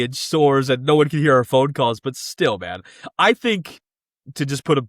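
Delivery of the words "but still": normal talking voice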